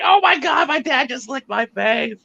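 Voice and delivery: put-on high squeal of a grossed-out kid; father imitating his kids' disgust, squealing high; mock disgusted kid. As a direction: mimic your kid, shrill and appalled. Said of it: "And they're just like".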